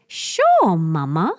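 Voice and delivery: put-on cute copied reply of a toddler; toddler's sing-song sure. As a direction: mom mimics daughter's chirpy yes. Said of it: "She goes".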